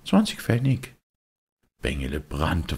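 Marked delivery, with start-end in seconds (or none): 0.1-1.0 put-on high, know-it-all kid; a cocky boy brushing him off; smug boyish voice; the boy's voice. Boy answers.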